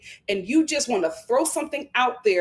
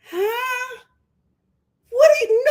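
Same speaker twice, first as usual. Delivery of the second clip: high disbelief; 11 semitones higher, 4 dB louder than usual. Retold or imitated. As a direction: pitch way up, incredulous. Everyone reacting in disbelief.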